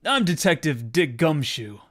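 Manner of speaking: loud, gravelly tough-guy cop; gravelly detective voice. Acted. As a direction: growl it like a gruff old detective. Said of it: "Doing those gravelly voices is killing me".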